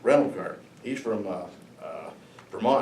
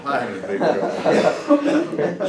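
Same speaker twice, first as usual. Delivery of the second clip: laughing; 5 semitones higher, 8 dB louder, much slower than usual. Real, not acted. Realizing he is in trouble.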